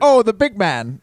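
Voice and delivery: kebab guy greeting. Retold or imitated. Kebab seller greeting a customer, loud hearty welcome. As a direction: hail him like a cheery kebab seller. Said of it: "He's like".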